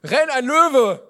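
loud, high panicked warning; alarmed shout. Acted. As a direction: yell it like a lion is coming. Warning of a lion.

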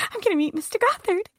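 put-on high, eager child voice, starstruck; imitating her eleven-year-old starstruck self; excited little girl. Child eager to meet celebrity.

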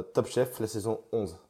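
ordinary talk

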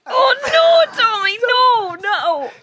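loud horrified cry of no; dismayed shout. Real, not acted. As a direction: shout it in disbelief. Dismay at sandals.

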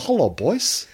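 high drawn-out cartoon turkey hello, lifting on boys; Terry the Turkey. Shown as a demonstration. Cohost demands the catchphrase again, high turkey voice. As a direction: pitch it up like a daft cartoon turkey. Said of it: "Do it again".

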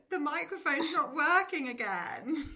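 high, loud laughing wail; hammed-up despair through laughter; mock distress. Friend jokes about a silly work crisis, in mock distress.

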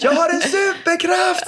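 put-on high, slow proud kid yell; excited child shout. Daughter boasting from the other room, high excited shout. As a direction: shout it like a little superhero. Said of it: "skriker hon där inne".

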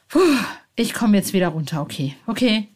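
ordinary talk, nothing marked

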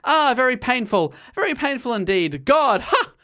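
hammed-up upper-class Englishman whining about being shot; phony British accent. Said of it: "he would say under his breath with a phony British accent".

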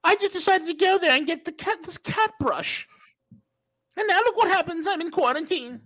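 high whiny mock voice; mock the whining traveller.

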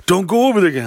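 high, worked-up mock warning; imitating excited friend. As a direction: imitate a friend getting all agitated.

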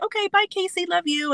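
put-on high, sweet child's goodbye; be a tiny girl leaving her dog; little girl voice. As a little girl to the dog Casey, high and sweet.

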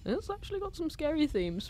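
small, high, soft protest; imitating worried younger self. Retelling own sleepover reaction, high timid protest. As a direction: pipe up quietly and high. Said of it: "And I was like".

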